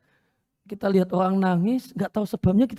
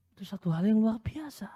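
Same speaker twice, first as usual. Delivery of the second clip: whispered; 3 semitones higher, 6 dB quieter than usual. Real, not acted. Awed aside.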